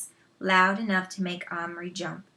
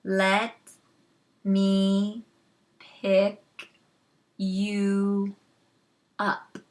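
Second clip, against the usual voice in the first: each word spaced apart and louder; slow exaggerated syllables to a foreigner; very slowly; 3 dB louder, much slower than usual. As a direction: spell it out word by word. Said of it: "then said very slowly".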